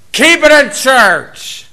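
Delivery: slow, high shouted taunt; yelled heckle. Retold or imitated. Heckler yelling at the street preacher, loud taunt. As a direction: bellow it out a car window. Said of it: "one guy yells out the window".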